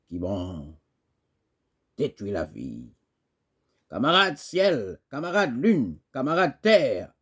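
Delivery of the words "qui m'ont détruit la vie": very low, soft recitation; low, bitter lament. Acted. Poet on those who ruined a life, low and hushed. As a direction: drop right down, almost to yourself.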